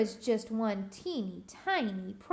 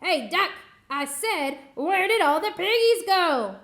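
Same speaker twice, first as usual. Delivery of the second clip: higher, much louder shout; furious witch yell; 5 semitones higher, 8 dB louder than usual. Acted. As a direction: bellow at the duck. Witch yelling at duck.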